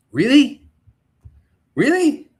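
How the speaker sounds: high, loud disbelieving question; incredulous. Real, not acted. Reacting to absurd casting news.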